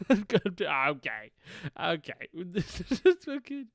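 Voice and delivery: giggling. Real, not acted. Laughing at own rant.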